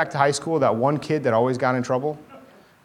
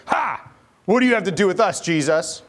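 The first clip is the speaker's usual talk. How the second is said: high, loud mocking demon cry; 6 semitones higher, 3 dB louder than usual. Shown as a demonstration. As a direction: spit it at Jesus like a taunting demon. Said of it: "and then you say".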